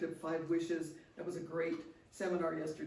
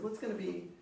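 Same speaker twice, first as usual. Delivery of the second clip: mock cheery invitation; 6 semitones higher than usual. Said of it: "I said".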